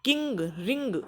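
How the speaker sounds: किंग with ng merged; teacher models ng; ng sound demo. Shown as a demonstration. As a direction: blend n and g in किंग. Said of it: "न और ग को मिला दो".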